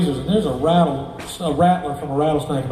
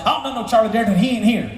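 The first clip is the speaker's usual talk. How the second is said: put-on high-pitched confused old-timer; 7 semitones higher, faster than usual. Musician misunderstanding the visitor from the shop, cantankerous. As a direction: protest in a high caricature.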